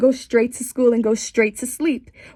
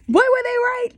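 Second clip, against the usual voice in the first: sarcastic; 13 semitones higher than usual. Real, not acted. Sarcastic punchline about Cinderella.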